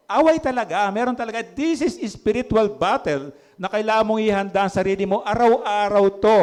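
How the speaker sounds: preacher urging the church to prepare for daily battle, fervent; fervent preaching. Acted. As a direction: rouse the congregation for daily battle.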